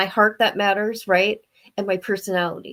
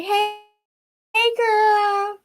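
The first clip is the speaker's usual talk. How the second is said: squeaky greeting; 14 semitones higher, much slower than usual. Real, not acted. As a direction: squeal it out happily.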